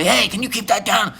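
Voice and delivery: high-pitched imitated yelling; hammed-up retold shout at the neighbor; mock yell. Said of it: "It was probably yelling".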